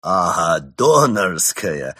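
loud, drawn-out happy realization; relieved delight. A narrator's reading. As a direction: exclaim with relief, stretching the word. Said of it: "обрадовался Крот". (обрадованно, громко и протяжно)

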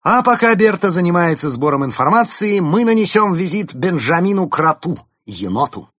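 громко и высоко, с подъёмом; зажечь друзей на поход; воодушевлённый призыв. Acted. Улис зовёт в поход. (бодрый призыв)

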